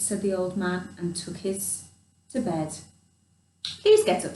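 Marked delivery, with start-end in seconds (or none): 3.8-4.4 high, squeaky mouse plea; little mouse pleading. Acted. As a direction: beg him like a tiny mouse. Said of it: "said Mouse".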